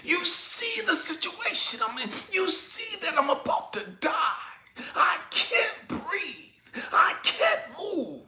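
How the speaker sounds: high, drawn-out pleading; desperate plea to God. Retold or imitated. Man about to die begging God for help, desperate and high. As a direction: strain upward, slowing on each plea for help. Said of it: "having this conversation in my head with God".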